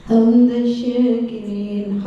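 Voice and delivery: normal talking voice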